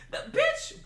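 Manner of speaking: laughing squeal. Real, not acted. Laughing at the overpriced Pepsi tab, high squeal.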